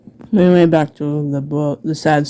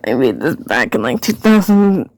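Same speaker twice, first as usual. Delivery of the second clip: odd put-on voice; 2 semitones lower than usual. Aside about old notebook.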